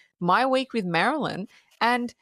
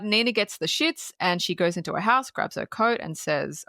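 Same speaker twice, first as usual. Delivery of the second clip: usual speaking voice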